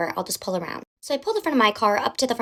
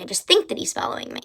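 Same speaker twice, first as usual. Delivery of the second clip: normal talking voice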